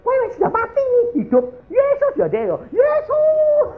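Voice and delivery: very high, acted hysterical pleading; frantic screaming. Wife urging her dying husband to live, acted frantic screaming. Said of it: "teriak tambah kenceng".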